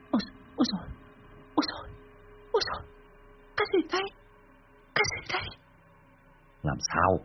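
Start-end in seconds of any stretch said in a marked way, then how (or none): 0.1-5.6 high, breathless panicked cries; terrified gasps. Acted. Wife waking from nightmare, high panicked cries. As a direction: gasp in terror just woken.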